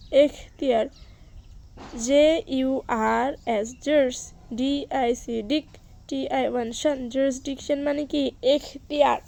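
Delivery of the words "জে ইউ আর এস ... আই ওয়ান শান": slow letter by letter, then each syllable; spelling out. Shown as a demonstration. Teacher spelling jurisdiction for the class, slowly, letter by letter.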